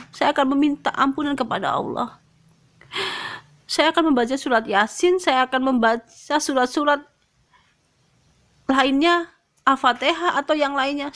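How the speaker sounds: high-pitched, earnest plea to God; pleading prayer. Retold or imitated. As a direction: retell a heartfelt bargain with Allah. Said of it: "Saya bertanya kepada Allah". (doa memohon dengan nada tinggi)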